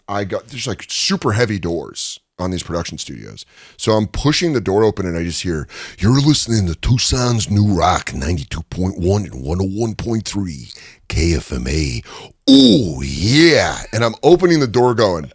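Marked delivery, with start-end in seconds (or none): 6.0-13.8 slow raspy station ID ending in a cry; Macho Man radio drop. Retold or imitated. Macho Man giving radio drops, slow and raspy. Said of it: "Macho Man".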